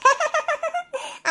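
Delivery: high, rapid cackling giggle; mocking laugh. Sister laughs at the fallen girl, mocking cackle. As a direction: burst into a gloating laugh.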